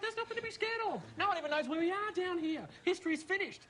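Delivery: calm him down with exaggerated sweetness; mock soothing. False soothing of scared friend.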